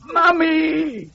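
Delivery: put-on a child's high, terrified scream for his mother; high scared child screaming Mummy; frightened scream. A narrator's reading. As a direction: shriek for help, high and scared. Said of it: "screamed Nigel".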